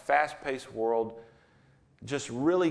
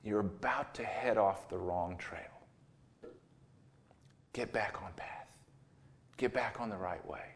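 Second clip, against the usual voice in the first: softer, tender voice of the Spirit speaking to Phil; gentle loving nudge; 4 semitones lower, 7 dB quieter than usual. Retold or imitated. The Holy Spirit gently guiding Phil back on track, tender and soft. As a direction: quietly coax Phil back like a caring friend, soft and low. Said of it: "Just this gentle".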